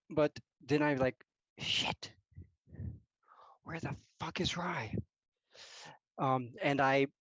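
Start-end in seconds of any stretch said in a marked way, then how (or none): 1.6-4.9 worried mutter. Acted. Character worried, muttered to self.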